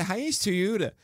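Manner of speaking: put-on Hyannis Toyota in a Baltimore accent, Toyota said as Tuuda. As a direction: mimic the Baltimore ad man saying Hyannis Toyota. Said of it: "But he would always say, ... What is this accent".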